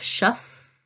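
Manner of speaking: ordinary delivery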